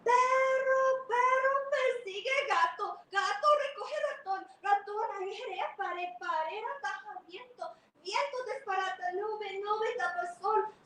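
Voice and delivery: high, loud pleading chant; tiny ant begging the dog; pleading little ant. Acted. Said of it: "She said".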